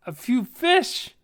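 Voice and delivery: high, loud incredulous question; indignant question. Acted. Half-elf challenging the dwarf over his motives, indignant. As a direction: rise sharply in outrage.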